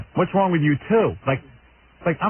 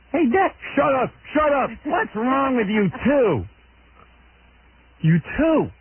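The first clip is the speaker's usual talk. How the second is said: much higher, mock shout at the family; mock family yelling; 12 semitones higher, slower than usual. Grown daughter replaying the tape's yelling. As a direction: ham up the family quarrel. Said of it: "That little confrontation".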